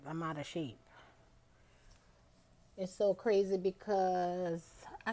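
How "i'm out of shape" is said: winded, quiet; breathless after a ride; out of breath. Real, not acted. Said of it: "out of breath".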